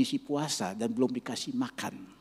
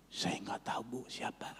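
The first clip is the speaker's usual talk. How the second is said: breathy hushed reply, much softer; whisper; 3 semitones lower, 9 dB quieter than usual. Retold or imitated. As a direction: whisper back to play along. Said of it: "saya juga ikut suaranya".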